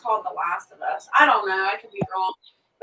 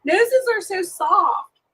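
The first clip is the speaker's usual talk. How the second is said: tender; 9 semitones higher, 7 dB louder, much slower than usual. Real, not acted. Cooing over a horse's nose.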